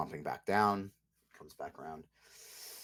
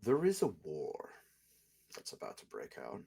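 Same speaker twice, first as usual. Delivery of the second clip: cold noble lord, menacing; 6 semitones higher than usual. Acted. GM voices lord character.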